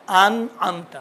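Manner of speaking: انعمت with clear throat ain; careful انعمت; ain demo. Shown as a demonstration. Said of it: "انعمت کہاں".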